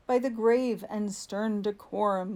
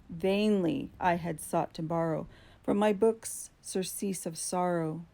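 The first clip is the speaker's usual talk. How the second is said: usual speaking voice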